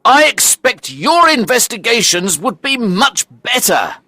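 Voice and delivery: high-pitched, aggrieved outburst; indignant shout. Acted. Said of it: "I shouted".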